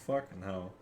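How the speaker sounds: ordinary delivery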